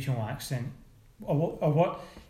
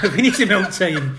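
laughing; 4 semitones higher, 10 dB louder than usual. Real, not acted. Laughing echo of own joke.

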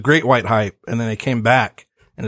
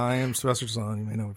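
softer Stallone mumble; mumbled Stallone; 12 dB quieter, much faster than usual. Acted. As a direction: mutter it like Stallone. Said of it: "interpret it myself".

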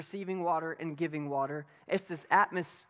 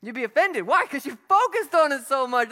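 loud and higher, driving; loud preaching; 8 semitones higher, 11 dB louder than usual. Acted. Pastor telling the congregation why they'd be offended, loud and high. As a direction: hammer the point home.

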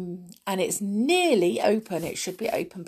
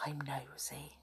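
whisper; 5 semitones lower, 20 dB quieter than usual. Real, not acted. Confiding aside to viewers.

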